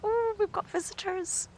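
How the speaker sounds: high, excited cub voice; voicing the cubs. Keeper voicing hyena cubs greeting visitors, high and excited. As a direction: speak for the cubs, squeaky-high and thrilled. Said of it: "they're like".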